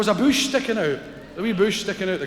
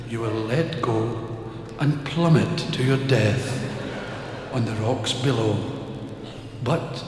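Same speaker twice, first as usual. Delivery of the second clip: solemn voice of God; 6 semitones lower, 4 dB quieter than usual. Acted. God serenely telling the man he will fall, solemn. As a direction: pronounce his fate calmly.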